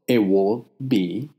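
ordinary talk

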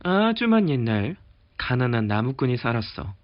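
plain reading voice

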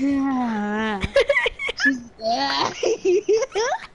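uncontrollable shrieking laughing fit; monkey-like laugh. Real, not acted. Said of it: "Now you're laughing like a monkey".

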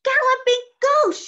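high, loud astonished witch cry; shout. A narrator's reading. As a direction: shout it out in amazement. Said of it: "she shouted".

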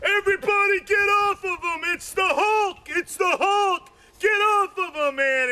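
high urgent mock yell; sergeant yelling. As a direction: order the pile off him in a panic. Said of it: "he starts yelling".

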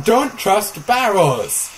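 loud and slow, every word hit; slow emphatic warning. Pretend. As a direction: drive the lesson home slowly. Mock moral of the lesson.

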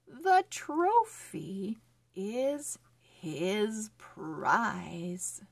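slow, careful storybook reading; reading to children. Acted. Teacher reading a phonics story to children, slow and clear. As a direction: read each word clearly for young readers.

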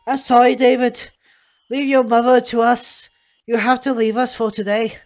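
loud staff member taking charge; firm hospital carer. Acted. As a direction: send the visitor away in a big, firm voice. Plays hospital staff figure.

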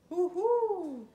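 ordinary delivery, nothing marked

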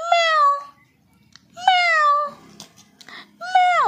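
very high, loud playful meow; cat meow for baby. Shown as a demonstration. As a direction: meow high like a kitten to make her laugh. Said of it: "ও ম্যাও ম্যাও করলেই ও হাসে". (উঁচু গলায় বিড়ালের ম্যাও)

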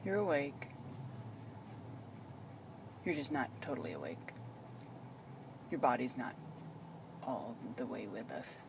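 ordinary talk, nothing marked

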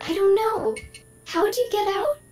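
AI Emily losing composure under questions; rising stress. Acted. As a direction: spiral into anxious questions. AI voice getting stressed.

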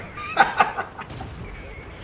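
long unvoiced cackling laugh; cackle. Commentator enjoys own sandwich joke, cackling. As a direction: crack up at your own joke.

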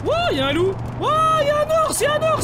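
high, loud startled yelling; excited shout. Real, not acted. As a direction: shout out in amazement. Spots wild animals in game. (cri excité)

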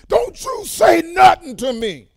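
high, slow, full shout imitating a menacing man; mock-possessed bellow, each word drawn out; imitated angry outburst. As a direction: act out his sudden loud warning. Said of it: "Just like that".

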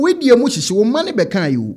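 normal talking voice

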